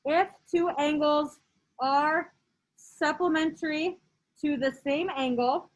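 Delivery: slow, each phrase spaced out; slow dictation. Shown as a demonstration. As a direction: read it slowly for notes. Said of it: "So this one says".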